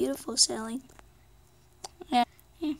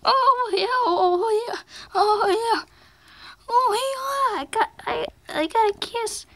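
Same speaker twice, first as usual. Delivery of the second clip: much higher and louder, jubilant oh yeahs; thrilled shouting; 10 semitones higher, 6 dB louder than usual. Pretend. Stickers cheering loudly after Sally's kiss, thrilled. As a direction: whoop it out like you just got kissed.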